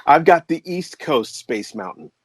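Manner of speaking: usual speaking voice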